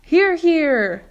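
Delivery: very high, loud cheer; cheering shout. Acted. Creatures agreeing with the ladybug, loud high cheer. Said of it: "they all shouted".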